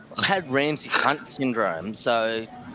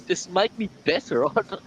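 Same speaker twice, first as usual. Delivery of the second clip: laughing; 8 semitones higher, 11 dB louder than usual. Retold or imitated. Retold plea, laughing.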